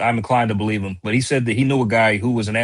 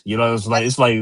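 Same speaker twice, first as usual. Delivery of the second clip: ordinary talk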